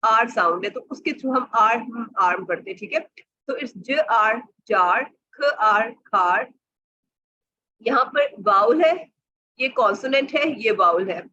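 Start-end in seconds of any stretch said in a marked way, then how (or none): 3.5-6.5 j r jar, each sound stretched; slow sounding out. Shown as a demonstration. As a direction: drag ख r, then blend खार. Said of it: "one sound के तौर पे". (धीरे-धीरे ध्वनि जोड़ना)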